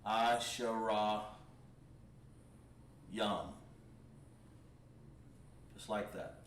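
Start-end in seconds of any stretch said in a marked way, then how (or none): 0.0-3.5 Asherah drawn out slowly, each syllable clear; teacher modelling Asherah Yam for the class; slow Hebrew pronunciation. Shown as a demonstration. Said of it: "we have to pronounce the word correctly".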